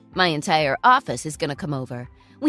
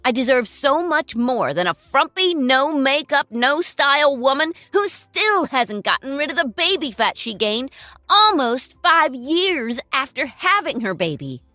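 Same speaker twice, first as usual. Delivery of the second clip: contemptuous; 2 semitones higher, 7 dB louder than usual. Acted. Ex-husband berates ex-wife.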